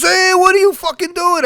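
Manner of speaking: high, loud bark from the roadside; father hollering at son in passing car, loud; dad yelling. Retold or imitated. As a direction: shout it like an angry father catching his son. Father yells from roadside work.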